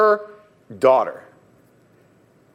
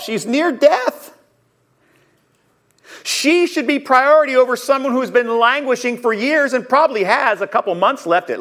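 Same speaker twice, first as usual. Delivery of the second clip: upset protest; 7 semitones higher than usual. Acted. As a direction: plead the emergency to Jesus. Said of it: "why they would be upset".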